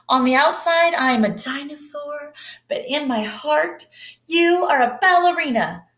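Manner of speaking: high, eager chorus; children crying out. Acted. Clara and Jack cheer for Bronterina, high and eager. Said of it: "cried Clara and Jack".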